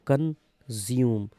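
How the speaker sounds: Consume slowly split as कन, ज्यूम; teacher slowly sounding out consume in two parts; broken into syllables. Shown as a demonstration. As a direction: pause between कन and ज्यूम in consume. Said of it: "word देखो".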